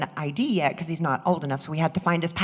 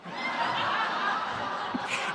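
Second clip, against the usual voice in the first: laugh; 7 dB quieter than usual. Real, not acted. Laughing at own scheme.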